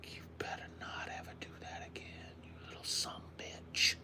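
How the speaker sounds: very soft, whispered warning; whispered threat. Real, not acted. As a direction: threaten the bug under your breath. Threatening the gnat under his breath.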